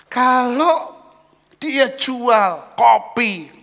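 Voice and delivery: loud, high and slow; slow emphatic preaching. Acted. Pastor does the math for the congregation, loud and slow. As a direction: drag each word out loudly.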